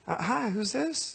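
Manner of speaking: high, drawn-out phone greeting. Retold or imitated. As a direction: imitate a shy pop star on the phone. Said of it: "And I heard".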